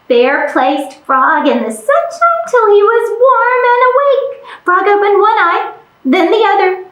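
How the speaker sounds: ordinary delivery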